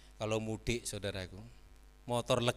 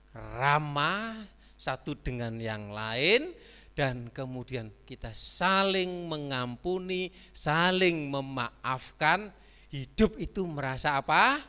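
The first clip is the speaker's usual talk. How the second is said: very slow, louder preaching; slow emphatic sermon; 2 semitones higher, 6 dB louder, slower than usual. Acted. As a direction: stretch each phrase to drive it home.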